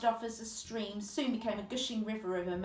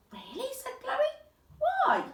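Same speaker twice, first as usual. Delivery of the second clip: put-on surprised girl; 5 dB louder, much slower than usual. Girl's surprised question.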